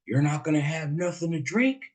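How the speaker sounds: a hint of a pushy country guy; pushy older man. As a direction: mimic the pushy host a little, with a touch of twang. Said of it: "he pounded his hand on the table and he looked at me and he said".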